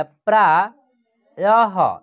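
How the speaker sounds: प्रायः drawn out slowly, ending in a breathy ह; slow clear reading. Shown as a demonstration. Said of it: "इसको हम लोग पढ़ेंगे प्रायः". (प्रायः को धीरे, अंत की ह ध्वनि के साथ पढ़ें)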